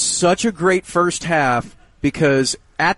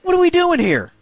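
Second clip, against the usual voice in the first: exasperated. Real, not acted. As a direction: throw up your hands in disbelief. Mock exasperation at limit.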